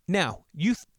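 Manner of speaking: normal talking voice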